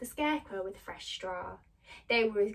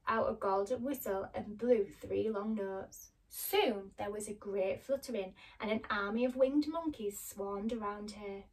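usual speaking voice

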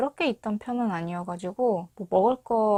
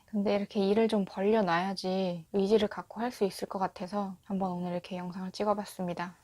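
normal talking voice

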